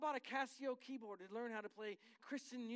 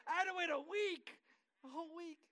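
higher, louder, laughing disbelief; laughing exasperation; 8 semitones higher, 6 dB louder than usual. Real, not acted. Husband telling church about waiting for her reply, laughing. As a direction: laugh at how long she made him wait.

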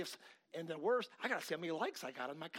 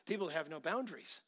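usual speaking voice

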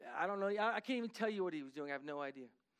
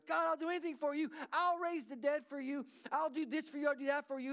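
higher and louder, fervent imitated promise; eager vow; 8 semitones higher, 5 dB louder than usual. Pastor Kim vowing anything to God, fervent and eager. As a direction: gush out the promises like an eager believer.

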